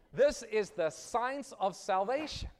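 loud, high, slow proclamation; preaching cry. Acted. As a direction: ring it out to the congregation. Preaching climax.

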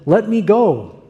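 urgent plea. Retold or imitated. Angel urging Jacob.

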